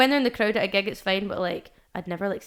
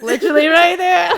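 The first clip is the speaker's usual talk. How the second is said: laughing as the panic bursts out, louder and higher; 10 semitones higher, 15 dB louder than usual. Retold or imitated. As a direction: blurt it mid-laugh, dad is right there. Spotting dad at the gig.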